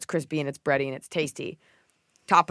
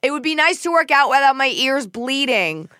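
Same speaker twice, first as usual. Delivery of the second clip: pointed retort; 8 semitones higher, 6 dB louder than usual. Retold or imitated. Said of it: "I go".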